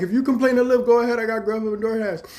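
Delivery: high, loud dare; defiant outburst. Retold or imitated. Daring the tester to complain, loud defiant outburst. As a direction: loudly call her bluff. Heated defiance after swearing.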